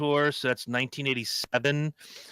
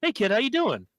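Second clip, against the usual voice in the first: higher, breezy hello; casual Gene greeting; 8 semitones higher than usual. Retold or imitated. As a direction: toss a greeting to a kid. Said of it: "he goes".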